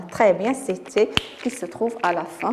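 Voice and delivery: ordinary talk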